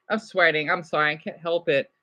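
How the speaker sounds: normal talking voice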